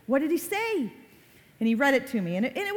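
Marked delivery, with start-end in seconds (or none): 0.1-0.9 higher, eager "are you kidding me"; mock thrill at the news from the coach; excited disbelief. Mom replaying her excitement when son got coach email. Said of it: "I'm like".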